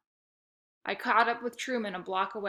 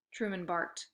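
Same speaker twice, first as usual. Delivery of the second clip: ordinary delivery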